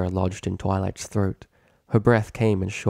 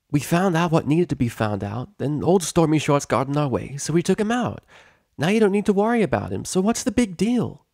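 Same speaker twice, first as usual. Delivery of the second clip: higher, fast, breezy boasting; cocky dismissal; 8 semitones higher than usual. Acted. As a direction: brush off the danger. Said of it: "less forcefully".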